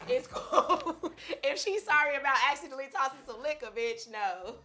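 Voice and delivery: high, loud, laughing; loud reaction. Pretend. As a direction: blurt it out loud. Acted reaction at concert.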